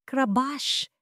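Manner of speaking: a sharp, high crash of glass; imitated glass crash. A narrator's reading. Narrator voicing the glass breaking on the floor, sharp crash sound. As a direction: make the sound of a glass shattering.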